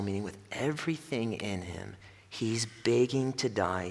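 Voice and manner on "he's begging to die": normal talking voice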